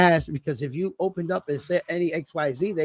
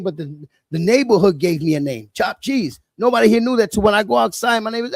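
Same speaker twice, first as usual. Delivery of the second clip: usual speaking voice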